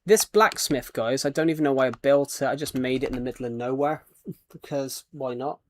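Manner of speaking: normal talking voice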